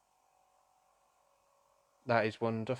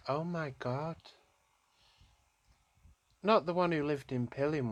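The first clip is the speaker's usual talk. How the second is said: shocked realisation; 6 semitones higher than usual. Real, not acted. Shocked realisation during a reading.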